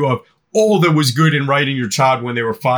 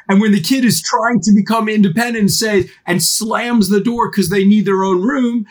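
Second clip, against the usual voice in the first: ordinary delivery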